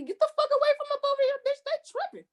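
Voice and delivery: high, fast, frantic pretend yell; panicked yell. Imagined yelling at police who pull up, high and frantic. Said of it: "my first instinct about to be".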